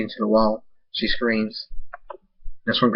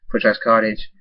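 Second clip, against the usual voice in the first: ordinary delivery, nothing marked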